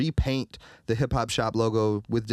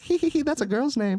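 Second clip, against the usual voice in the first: mock giggling tourist lady. Retold ladies teasing his name.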